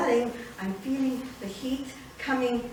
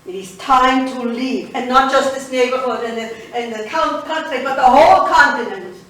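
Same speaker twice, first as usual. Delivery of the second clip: firm announcement; 9 dB louder than usual. Acted. Announcing they must leave.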